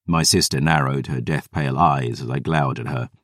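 usual speaking voice

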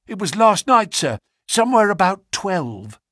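high, urgent servant's voice, gasping out the news; a shaken butler bursting in; breathless butler. A narrator's reading. Butler answers the Colonel.

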